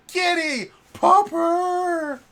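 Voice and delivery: high, loud squeal at a cat; excited pet call. Acted. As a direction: call the pets in a big high voice. Acts out calling to pets.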